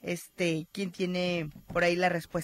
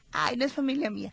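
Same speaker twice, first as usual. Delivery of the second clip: laughing quip; 3 semitones higher than usual. Retold or imitated. Joking about non-relatives.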